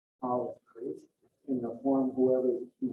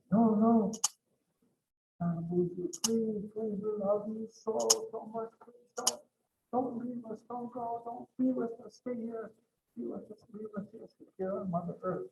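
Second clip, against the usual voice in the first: pleading; 10 semitones higher than usual. Acted. All creation begs Nanabuji not to leave, pleading. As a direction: beg in a high, hushed voice, repeating the plea.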